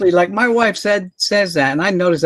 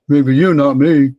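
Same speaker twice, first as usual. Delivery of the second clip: slow, louder imitation of a retort; mock comeback; 5 dB louder, much slower than usual. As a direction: throw back a drawn-out joking retort. Retelling son's shouted comeback.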